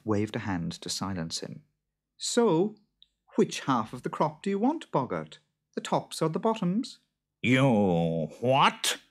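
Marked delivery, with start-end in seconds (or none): 2.2-7.0 high-pitched questioning wife voice; wife driving a bargain; farmer's wife. A narrator's reading. 7.4-9.0 puzzled creature voice; lightly voiced confused monster; baffled boggart. A narrator's reading.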